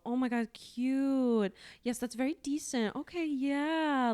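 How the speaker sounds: slow, stretched-out affected approval; affected silly voice. Retold or imitated. Imitated sales rep approving of things, affected silly voice. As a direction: coo it slowly in a put-on silly voice. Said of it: "silly voice".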